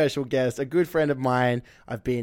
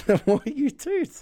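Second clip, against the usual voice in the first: high slow punchline; 10 semitones higher, slower than usual. Acted. Punchline delivery.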